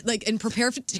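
ordinary talk

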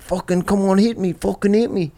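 high mock-Liverpool accent; Liverpool accent imitation. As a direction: goad them in a put-on Scouse accent. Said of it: "when I do your accent".

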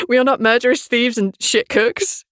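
ordinary talk, nothing marked

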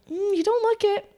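hammed-up sceptical reply; mock doubtful customer.

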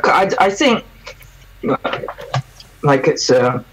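choking on food mid-sentence; choking on pizza while trying to talk. Real, not acted. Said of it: "sorry, just choking on a pizza here".

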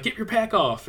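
urgent retold order. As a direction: act out hurrying your partner. Acting out urgent order to partner.

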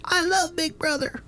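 very high, dazed surrender; brainwashed high voice. Retold or imitated. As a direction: chirp it like a convert. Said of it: "eventually it's like".

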